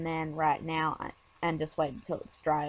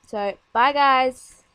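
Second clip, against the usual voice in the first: cheery goodbye; 2 semitones higher, 5 dB louder, much slower than usual. Real, not acted. Cheery sign-off.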